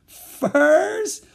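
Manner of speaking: very high, loud questioning shriek; piercing incredulous shout; astonished cry. A narrator's reading. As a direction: cry out again, rising in disbelief. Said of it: "he cried out".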